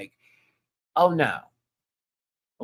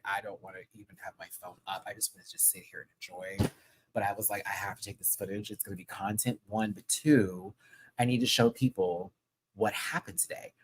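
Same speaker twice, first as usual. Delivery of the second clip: quiet; 4 semitones lower, 8 dB quieter than usual. Retold or imitated. Retold inner thoughts, very soft.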